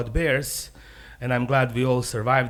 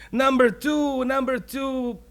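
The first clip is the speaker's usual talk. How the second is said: high, pleading little voice; urgent need in a raised, high pitch; lightly acted high-pitched desperation; desperate rabbit; 10 semitones higher, slower than usual. Desperate rabbit outside the toilet.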